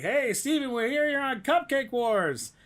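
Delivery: high, loud call across the street; paparazzi calling out. Retold or imitated. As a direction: call out to a celebrity leaving a restaurant. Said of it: "And they're like".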